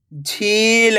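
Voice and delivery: झील with the ee stretched long; झील, held long for learners; drawn-out long vowel. Shown as a demonstration. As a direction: pull out the ee in झील. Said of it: "तो आपको खींच के पढ़ना है".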